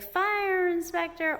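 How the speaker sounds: "fire inspector" high and slow, traced out; slow high mock read of "fire inspector". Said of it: "It was, like".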